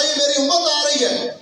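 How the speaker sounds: high, urgent appeal to God; Prophet's plea. Acted. The Prophet telling Allah his people are coming, urgent plea. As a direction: plead upward, pitched high. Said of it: "अल्लाह के नबी कहेंगे अल्लाह से".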